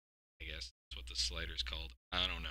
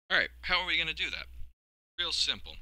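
upbeat host voice; 9 semitones higher, 5 dB louder, faster than usual. Pretend. Mock host bit.

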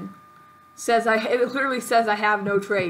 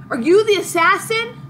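high, loud challenge; theatrical confrontation; 8 semitones higher, 6 dB louder than usual. Pretend. Hero confronting the suspected assassin, loud high question. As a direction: call it out in mock alarm.

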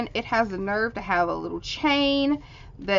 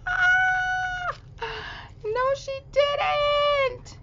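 very high, slow "no, she didn't" shout of disbelief; amazed squeal; much slower than usual. Real, not acted. Finding a hidden surprise in the package, shrieking with delight.

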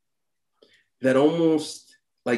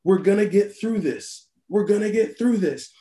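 higher, fired-up repeat of the nurse's words; pumped-up echo; 8 semitones higher than usual. Retold or imitated. Nervous doctor psyching himself up, hyped and energized. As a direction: say it like Popeye after the spinach. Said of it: "like the hero gets his spinach".

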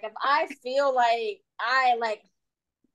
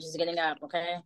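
just waking up; 5 semitones lower, 6 dB quieter than usual. Retold or imitated. Acts out mommy barely awake.

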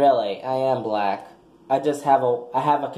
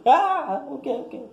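voice flip; 11 semitones higher than usual. Pretend. Showing the crowd how the voice flips up. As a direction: let the voice crack up high. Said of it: "See how I turn into".

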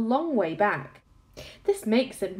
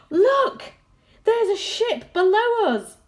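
excited high shout, slow; 13 semitones higher, 8 dB louder, slower than usual. A narrator's reading. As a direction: call it out, thrilled to see a ship. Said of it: "shouted James".

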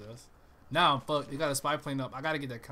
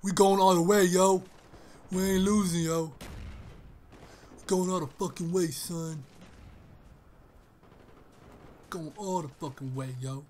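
lightly voiced hyped teammate, louder; 3 semitones higher, 5 dB louder than usual. Mimicking enemy team hyping each other up, loud. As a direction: pump up the squad like an enemy player.

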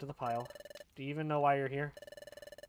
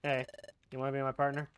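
ordinary talk, nothing marked

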